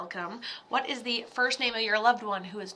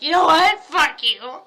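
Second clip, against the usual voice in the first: shouted You know what, Fuck you; mock telling off; 3 semitones higher, 10 dB louder than usual. Showing how to yell at the spirit, shouted loud. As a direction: yell it like you're finally done with him. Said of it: "to be like".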